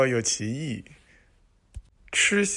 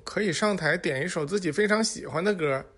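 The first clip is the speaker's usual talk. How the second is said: normal talking voice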